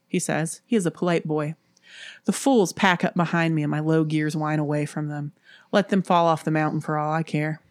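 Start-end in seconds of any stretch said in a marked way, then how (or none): none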